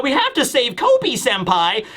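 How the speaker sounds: high-pitched mock-urgent cry; urgent plea. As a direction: imitate an eager junior begging for a rescue. Acts Hibari pleading for Kobe.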